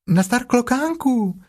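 high, slow friendly greeting; Piglet calling up. A narrator's reading. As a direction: call up the tree cheerfully. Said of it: "zvolalo prasátko". (prasátko vesele volá nahoru)

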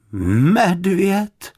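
high, drawn-out questioning MEDVĚD; sceptical, stretched-out query; doubtful echo. A narrator's reading. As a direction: repeat MEDVĚD slowly in disbelief. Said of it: "opakovala paní Ptáčková pochybovačně".

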